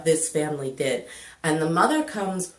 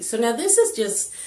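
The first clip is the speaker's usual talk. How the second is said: ordinary talk, nothing marked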